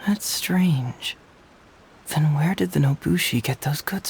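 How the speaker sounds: a hushed, thinking-aloud remark; whispered aside. Acted. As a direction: whisper it to yourself, puzzled. Puzzled aside to himself.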